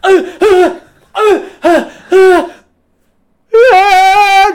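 high, loud wailing sobs, half-laughing; mock crying. Boy freed from the ropes bursting into tears, mock wailing. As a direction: wail like a bawling kid. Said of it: "just starts crying".

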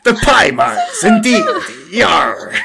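hearty pirate. Acted. Pirate embracing the crew's new lingo, hearty pirate shout. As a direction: crow it like a stage pirate.

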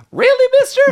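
put-on way up high, wide-eyed little boy; hammed-up boyish awe; gee-whiz kid voice. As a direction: squeak it out like an awestruck kid. Podcaster voicing the kid Charlie.

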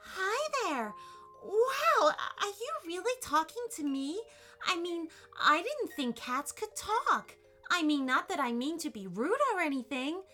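put-on high, flustered amazed girl; nervous chattering. A narrator's reading. Allison talking to a talking cat, nervous rambling. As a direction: gabble on in awed, mild nervousness. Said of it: "she realized she was nervous".

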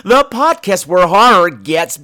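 loud, high horror-host welcome; sneering panto villain. Acted. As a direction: greet the audience like a hammy showman. Podcast intro, hosting voice.

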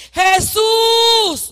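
high, long-held call to Jesus; crying out. Shown as a demonstration. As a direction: lead the congregation in crying out. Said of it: "empiecen a gritar".

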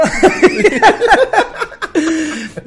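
long, high-pitched laughing fit; real burst of laughter. Real, not acted. Friend cracking up at the costume joke, real laughter. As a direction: laugh helplessly, high and drawn out.

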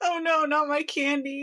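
mock whimper. Mocking Chikai losing his lollipops, mock whimper. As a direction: pretend to sob over lost candy.

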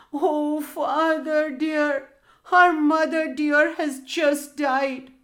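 put-on high, slow, tearful boy's voice; sobbing boy. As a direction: wail it like a boy in tears. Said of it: "sobbed the lad".

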